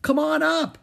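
high, loud inviting shout; excited high call from above. A narrator's reading. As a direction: holler down to a friend. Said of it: "Annie shouted".

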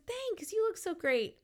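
high, pleased retold thanks; acted-out happy reply; happy and delighted. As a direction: return a compliment delightedly. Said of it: "happy and delighted".

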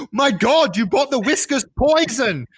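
high, hammed-up cry of horror; mock panic, wailing like an appalled kid; freaked out. Son reading poisson as poison, freaked out. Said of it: "freaked out".

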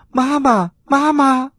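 high, excited loud cries; repeated loud calling. Acted. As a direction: cry out high, one call after another. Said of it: "连声地大喊".

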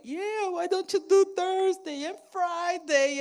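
high, loud, sly devil voice; tempting devil. Retold or imitated. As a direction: coax her to put it off. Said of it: "the devil's going".

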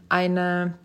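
ordinary talk, nothing marked

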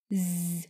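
Z as a long, low, soft buzz; voiced Z sound. Shown as a demonstration. Teacher contrasts two sounds, Z buzzed with voice. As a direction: hum a sustained Z. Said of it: "Hai âm Z và S".

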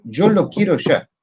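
angry boss. Retold or imitated. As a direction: spell out each word like a spoilt boss. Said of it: "un project manager que dice". (jefe terco, lento y exigente)

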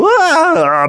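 high, loud mock yelp; startled yelp. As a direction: yelp it playfully. Tiger leaping in surprise.

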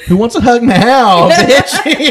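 high, loud, slow drawn-out call; hammed-up taunt; mock taunting call. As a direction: call it out like a playful challenge. Jokingly calls out for a hug.

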